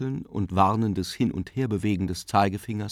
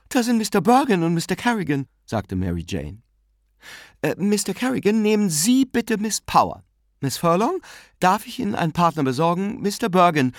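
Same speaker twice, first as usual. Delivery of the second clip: higher, brisk hostess voice; a hint of a bustling young woman; lightly higher, quick organising voice; lightly voiced young hostess; 9 semitones higher, 4 dB louder than usual. A narrator's reading. Mary Jane's held voice.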